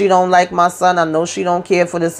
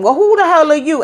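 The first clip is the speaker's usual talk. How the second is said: high indignant retort; 9 semitones higher than usual. Retold or imitated.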